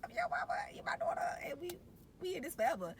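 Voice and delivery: put-on very high, tender baby talk, partly whispered; tender to newborn. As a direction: coo to the new daughter. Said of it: "I was just like".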